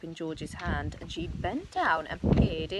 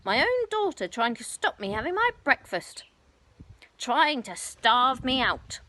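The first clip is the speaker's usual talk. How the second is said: self-pitying rant; 5 semitones higher, 6 dB louder than usual. Acted. Grandma ranting on.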